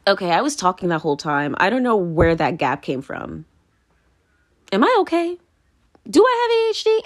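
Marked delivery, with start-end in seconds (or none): 4.7-7.0 pitched way up, half-joking panic; mock alarm. Joking to viewers about a memory gap, in high mock alarm. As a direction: squeak it out in fake worry.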